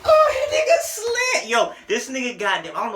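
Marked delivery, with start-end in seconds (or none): 0.0-1.5 high, loud, stretched-out yell of disbelief; hyped shout. Real, not acted. Reacting to the song, a loud hyped shout. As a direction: cry out like the verse just floored you.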